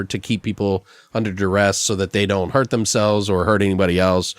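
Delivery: normal talking voice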